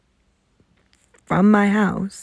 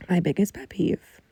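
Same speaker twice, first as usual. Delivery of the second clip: ordinary delivery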